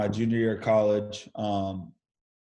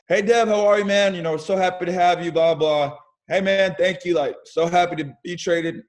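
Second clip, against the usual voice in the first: imitating an upbeat GM, high and loud; cheery GM; 6 semitones higher, 4 dB louder than usual. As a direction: play the beaming general manager. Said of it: "Alex Anthopoulos".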